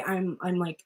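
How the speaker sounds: ordinary delivery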